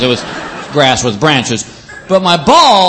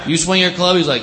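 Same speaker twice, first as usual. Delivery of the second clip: ordinary talk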